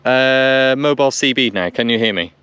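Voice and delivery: normal talking voice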